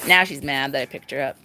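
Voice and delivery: usual speaking voice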